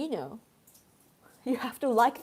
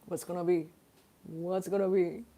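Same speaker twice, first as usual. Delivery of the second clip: low teasing; 5 semitones lower than usual. Real, not acted. Teasing reveal of the snack.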